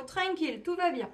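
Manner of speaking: lightly imitated high, easygoing calm; carefree reassurance. Imitating Moroccans unbothered by lockdown and no work, carefree. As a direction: lift it high, untroubled. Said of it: "pas de boulot".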